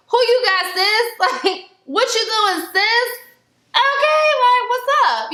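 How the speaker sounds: put-on high sassy girl taunt; mocking high voice. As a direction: squeal it like teasing girls. Mimicking friends taunting, laughing.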